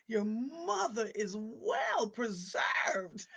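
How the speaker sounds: drawn-out, very slow mock-solemn compliment; slow solemn neighbor. Neighbor telling her son about his mother, slowly and gravely. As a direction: lay it on slowly, like a grave verdict.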